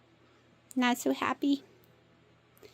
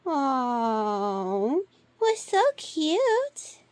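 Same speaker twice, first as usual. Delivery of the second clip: cooing; much slower than usual. Real, not acted. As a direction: coo over an adorable pet. Cooing over the cat.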